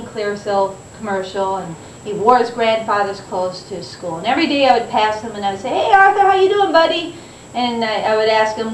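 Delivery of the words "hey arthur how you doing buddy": teasing greeting. Retold or imitated. Teasing a classmate at school.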